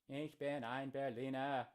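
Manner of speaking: German in a broad Boston accent; Kennedy-style Boston accent on German. Shown as a demonstration. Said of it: "my best Boston accent while speaking German".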